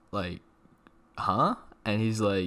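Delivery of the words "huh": high, loud baffled huh; confused huh. Retold or imitated. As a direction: react like you can't believe it. Retold confused reply to job offer.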